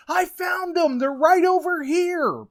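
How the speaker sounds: high, loud imitation of an excited kid; hollering kid. Kid on the shore pointing in the water, hollering. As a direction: holler the big discovery in a kid's high voice.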